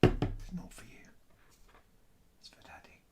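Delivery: soft whisper telling the dog it's Daddy's medicine. Real, not acted. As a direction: whisper it to the puppy like a secret. Hushing dog away from medicine.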